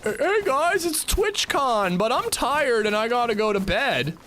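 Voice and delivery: high-pitched mock party pooper; mock whiny excuse. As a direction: beg off in a lightly hammed-up high voice. Mock quote of himself at TwitchCon.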